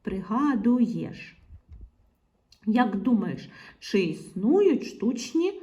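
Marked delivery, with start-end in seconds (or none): 0.0-1.4 slow "пригадуєш", vowels held; syllable-by-syllable. Shown as a demonstration. Teacher reading back the word with є inserted, drawn out. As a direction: draw out ПРИГАДУЄШ to its final syllable.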